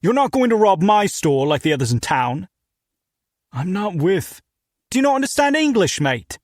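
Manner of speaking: put-on thick British accent, shouted through the door; angry British shop owner yelling; loud British accent. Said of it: "His British accent was thick and his voice loud".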